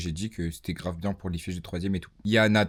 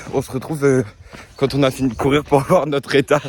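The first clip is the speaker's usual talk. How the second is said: while running; 5 semitones higher, 13 dB louder than usual. Real, not acted. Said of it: "On est en train de courir".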